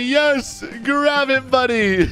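high, cheerful encouragement; gleeful yes, breaking into a laugh; excited go-ahead. Acted. Happily allowing the child ice cream.

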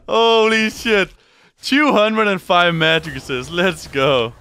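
slow, high, loud drawn-out marvel; amazed shout. Real, not acted. Streamer marveling at his absurd resistances, slow loud awe. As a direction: stretch each word in awe.

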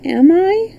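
high, small rising question; shy little boy's query; timid question. A narrator's reading. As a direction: ask it timidly, hoping it is true. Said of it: "asked timidly".

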